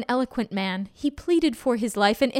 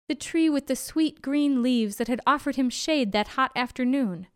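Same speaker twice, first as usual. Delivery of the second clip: usual speaking voice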